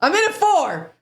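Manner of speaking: high put-on voice; silly squeaky character voice; mock character voice. Mock character quote, far high.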